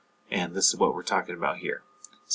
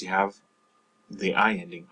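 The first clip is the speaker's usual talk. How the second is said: ordinary talk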